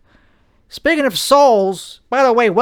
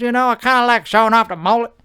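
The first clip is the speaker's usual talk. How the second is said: usual speaking voice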